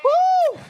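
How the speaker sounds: high shouted woo; excited woo. Real, not acted. Celebrating eight days of boxing, high whoop. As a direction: whoop with joy.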